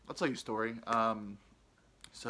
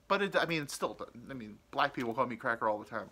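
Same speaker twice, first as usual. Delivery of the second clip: normal talking voice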